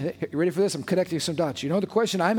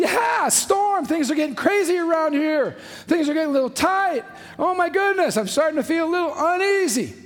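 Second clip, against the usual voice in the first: much higher, hammed-up nervous voice; mock uneasy disciple; 9 semitones higher than usual. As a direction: play a jittery disciple in the storm. Acting disciples' worry in storm.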